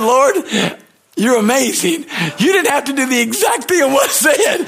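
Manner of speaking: high, loud, astonished praise; laughing shout of wonder at God; excited exclaim. Retold or imitated. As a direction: call out to the Lord in amazed delight.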